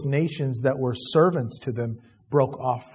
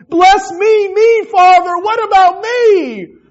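high, loud whining brat begging for more; a grown son wailing like a brat, much higher and louder; spoiled brat; 12 dB louder, slower than usual. Retold or imitated. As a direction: whine it like a spoiled child wanting his way. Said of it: "He just sounds like a spoiled brat".

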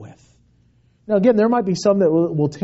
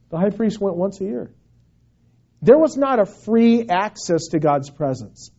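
ordinary delivery

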